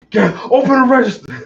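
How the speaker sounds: high, loud mock shout through laughter; laughing impression of a gunman; imitating robber. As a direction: bark the robber's order while cracking up. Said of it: "Come from that bitch".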